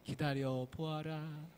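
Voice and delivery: slow, drawn-out spirit voice; mountain god. Acted. Mountain god telling Nolbu to wait, slow. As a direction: make him wait, slow and grand.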